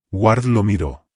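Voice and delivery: plain reading voice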